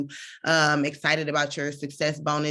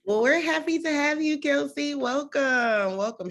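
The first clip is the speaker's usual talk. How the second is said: high, lifted welcome; bright excited welcome; 11 semitones higher than usual. Real, not acted. Host welcoming new member Kelsey, excited high welcome. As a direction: greet the newcomer with delight.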